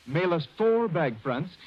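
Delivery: ordinary talk, nothing marked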